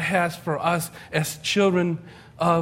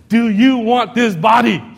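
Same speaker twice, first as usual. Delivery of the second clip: higher, louder, slow announcer hype; infomercial pitch; 6 semitones higher, 8 dB louder than usual. Retold or imitated. Late-night fitness commercial pitching to viewers on the couch, announcer hype. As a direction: sell the rock-hard abs. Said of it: "It says".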